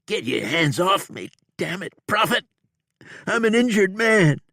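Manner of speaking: high, loud indignant yell; outraged wounded man, high and loud; angry outlaw. A narrator's reading. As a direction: shout at him to let go. Bob shouts at Prophet.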